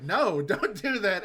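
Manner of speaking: loud, high cartoon protest; dad stopping his son from dressing up; mock panicked no. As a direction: shout it like a horrified dad. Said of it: "he's just like".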